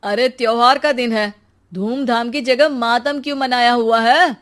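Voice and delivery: loud call down the empty street. Acted. Boy calls to villagers hiding indoors, loud. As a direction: shout so every closed house hears.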